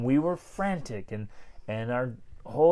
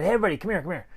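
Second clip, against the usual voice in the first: put-on upbeat, higher-pitched call to the kids; cheerful, smiling; 6 semitones higher, 3 dB louder, much faster than usual. Parent rallying the kids to help clean, cheerful and smiling. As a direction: call everyone over with a smile. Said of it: "Went in with smiles".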